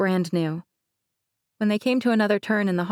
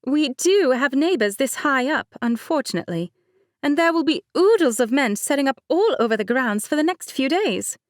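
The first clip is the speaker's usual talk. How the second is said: light higher-pitched bride, laughing it off; Mercedes voice; 7 semitones higher, 4 dB louder than usual. Acted. Bride Mercedes teasing her cousin, higher light voice. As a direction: play the bride breezily.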